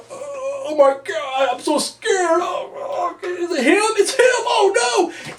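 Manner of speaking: high, drawn-out mock-scared wail; wildly overdone fake fright; Hogan overacting terror. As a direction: ham up the panic, building to a shrieked finish. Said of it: "he just goes into overact mode".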